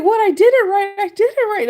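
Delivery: high, loud defensive "I did it right"; mocking the nurse. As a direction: play him protesting innocently. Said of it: "he's like".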